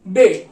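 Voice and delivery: बे said as bay, not bee; clear, isolated बे; German B. Shown as a demonstration. Teacher modelling the German letter to students. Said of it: "इसको हम pronounce करते हैं बे".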